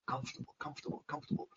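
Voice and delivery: whispered galloping chant; horse-like rhythm. Shown as a demonstration. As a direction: whisper it in a steady hoofbeat rhythm. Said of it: "It sounds like a horse".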